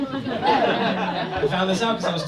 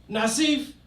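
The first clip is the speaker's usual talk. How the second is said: higher, louder mock trash talk between friends; teasing buddy; 6 semitones higher, 6 dB louder than usual. Friend ribbing the dad on the phone, playful loud taunt. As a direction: rib him like an old friend.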